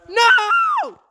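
screamed high no; anguished denial. Real, not acted. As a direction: yell it in panic. Attacked, screaming no.

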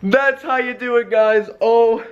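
high triumphant shout; excited shout. Real, not acted. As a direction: yell out the win in triumph. Winning the trade, taunting Kringley.

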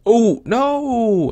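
high, loud oh no; shocked shout. Real, not acted. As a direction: yell in dismay. Gets eaten.

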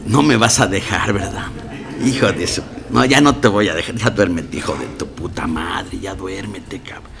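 voz baja e insistente del celoso, luego de ella cansada; súplica celosa imitada. Retold or imitated. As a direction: ruega sin dejarla dormir, en voz baja. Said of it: "Pero aún así me aventaba". (ruego celoso en voz baja, imitado)

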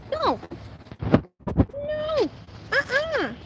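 high-pitched dog scolding. Real, not acted. Owner telling off her puppy Ember on the leash, high-pitched scold.

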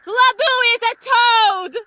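very high sustained yell; scream. Acted. Screaming insults to wreck the voice, high-pitched scream. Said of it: "I want you to scream".

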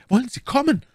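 very high, exasperated demand; urgent call. A narrator's reading. Milady demands that Bonacieux come now, urgent call. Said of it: "rief My Lady".

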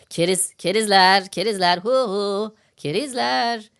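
slow, sing-song jeer at the suckers; mocking taunt. Host taunting scam victims in a slow mock jeer. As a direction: drag out kerizler like a playground taunt.

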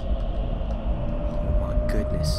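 defeated quiet groan. Real, not acted. Despair at the level.

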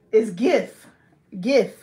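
loud "gif" with a hard G as in give. Shown as a demonstration. Correcting herself from the J sound, loud hard-G "gif". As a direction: punch "gif" out loudly with a hard G. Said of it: "did I say jif?".

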